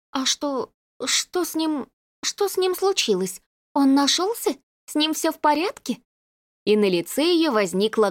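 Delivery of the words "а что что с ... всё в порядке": anxious questions. A narrator's reading. Anxious about missing man.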